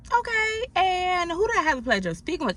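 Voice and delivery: chirpy call center politeness; polite phone voice. Retold or imitated. Agent greeting a caller in a high, bright phone voice.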